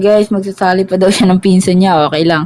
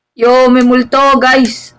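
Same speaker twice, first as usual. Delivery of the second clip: louder, higher frightened call; alarmed; 6 semitones higher, 5 dB louder, slower than usual. Real, not acted. Streamer warning viewers about a ghost, alarmed. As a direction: cry out that a ghost is here.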